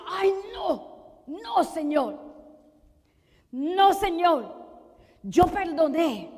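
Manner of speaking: loud, high, impassioned refusal in prayer; preacher's full-force shout, phrase by phrase; forceful declaration. Acted. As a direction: cry it out to God with total resolve. Models defiant prayer refusing resentment.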